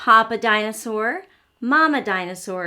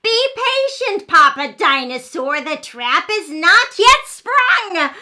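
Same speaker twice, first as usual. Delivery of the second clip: loud, higher, slow scheming dinosaur; 6 semitones higher, 7 dB louder than usual. Acted. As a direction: hiss the plan loudly from the woods. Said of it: "loud noise that sounded kind of like".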